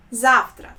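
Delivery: завтра as ZAF-tra, v turned to f. Shown as a demonstration. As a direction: model завтра with an f in the middle. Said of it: "also pronounced as F".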